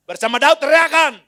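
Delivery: full-voiced rally shout. Shown as a demonstration. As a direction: shout it out to the crowd. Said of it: "Gak usah gitu, bawahnya aja".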